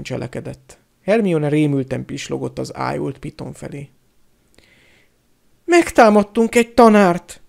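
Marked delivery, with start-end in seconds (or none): 5.6-7.4 high, loud, horrified voice; panicked stammer. A narrator's reading. A pupil aghast at having attacked a teacher, panicked stammer. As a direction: lament it, aghast at what you did.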